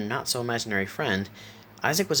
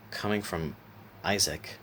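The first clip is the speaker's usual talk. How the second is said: ordinary delivery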